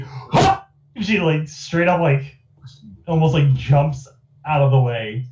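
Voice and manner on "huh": startled gasp. Acted.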